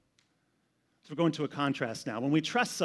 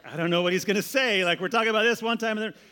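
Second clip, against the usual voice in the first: louder, fast, higher puzzled rush; mock confused congregation; 5 semitones higher, 6 dB louder than usual. Pastor voicing puzzled listeners, fast and louder. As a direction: blurt out playful confusion. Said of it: "You're like".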